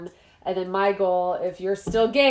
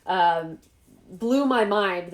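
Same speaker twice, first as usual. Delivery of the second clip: usual speaking voice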